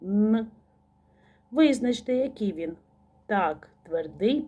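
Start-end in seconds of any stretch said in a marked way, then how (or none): 0.0-0.5 "Н" hummed alone; short sustained nasal "Н"; isolated sound. Shown as a demonstration. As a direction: sound out a pure "Н". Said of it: "Останній звук у слові".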